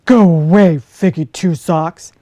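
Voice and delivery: loud, gruff big-bear voice; a big bear sending the fox away, loud and grumpy. Acted. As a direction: shoo her off like a cross old bear. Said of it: "he said".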